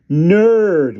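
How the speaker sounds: loud, high single word; said in unison. Acted. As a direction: call it out together. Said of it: "we both said in unison".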